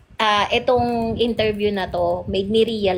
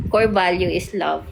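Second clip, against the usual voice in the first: normal talking voice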